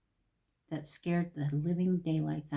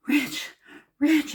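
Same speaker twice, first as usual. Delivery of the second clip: panicked calling; 8 semitones higher, 4 dB louder than usual. Retold or imitated. Retelling calling husband in panic.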